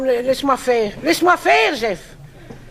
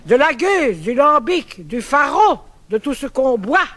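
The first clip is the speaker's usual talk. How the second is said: poem recital; 4 semitones lower than usual. Acted. Recites verse pastiche on accent.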